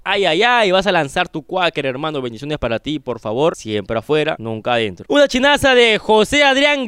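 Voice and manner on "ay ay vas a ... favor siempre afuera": ordinary talk, nothing marked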